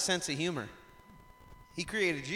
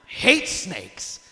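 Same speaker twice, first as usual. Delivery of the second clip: disgusted groan; 8 semitones higher, 9 dB louder, much slower than usual. Retold or imitated. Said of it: "I'm like".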